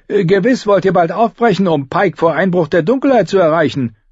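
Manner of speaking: high, quick innkeeper voice; hesitate, then chatter high to a customer; innkeeper character. A narrator's reading.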